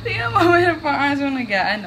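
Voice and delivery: high, loud, giggly words; laughing. Real, not acted. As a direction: talk through a fit of giggles. Cracking up during a recording.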